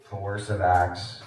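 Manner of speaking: very low, slow, drawn-out call; low droning roll call. Pretend. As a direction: drone it like a teacher waiting for an answer. Roll-call joke, then says "Bueller.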